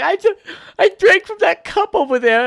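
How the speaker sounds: put-on high, loud, panicked child voice; scared little kid. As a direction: squeal it like a frightened seven-year-old. Seven-year-old self answering dad.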